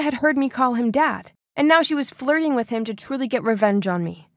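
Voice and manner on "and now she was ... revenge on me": plain reading voice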